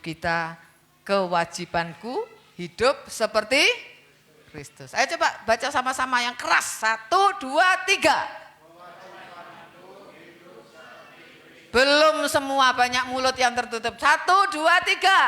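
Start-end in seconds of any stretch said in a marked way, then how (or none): 4.9-15.2 louder call of Satu, dua, tiga; rally the church to read aloud; loud count-in. Acted. Preacher counting the congregation in, loud rally.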